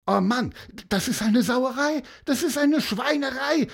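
put-on high-pitched, outraged boy's yell; agitated yelling. A narrator's reading. Boy protests the unfair result, agitated yelling. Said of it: "brüllte Mattis aufgeregt".

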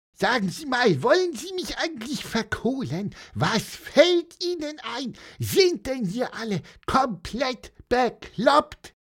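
high, furious bird squeal; raging squeak. Acted. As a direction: rant at the policeman like an enraged little bird. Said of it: "richtig laut an zu piepsen und zu wüten".

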